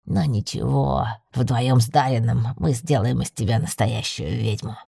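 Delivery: low male voice for the familiar; deep-voiced, patronising magical companion; knowing familiar. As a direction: tease the mistress in a deep voice. Familiar teases his mistress.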